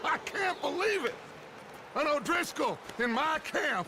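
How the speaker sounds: high, hammed-up disbelief; mock outrage. Gang leader taunting a captured man, hammed-up outrage. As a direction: play up the shock to rattle the prisoner.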